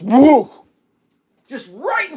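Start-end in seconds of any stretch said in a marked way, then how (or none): 0.0-0.5 loud, high alarmed woo; sudden loud yelp; startled whoop. Retold or imitated. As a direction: relive the shock of the bat. Bat flies past his face.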